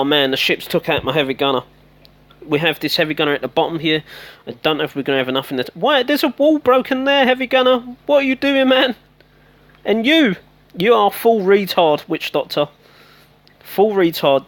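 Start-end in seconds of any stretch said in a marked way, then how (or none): 5.7-14.5 high, loud scolding of the troops; exasperated yelling at dumb units; exasperated shout. Real, not acted. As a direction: tell them off at full voice.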